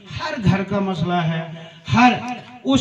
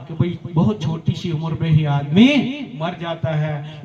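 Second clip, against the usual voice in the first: usual speaking voice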